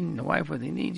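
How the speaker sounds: ordinary delivery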